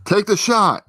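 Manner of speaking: hammed-up snap order; mock order. Agent ordering pilot to fire, mock command.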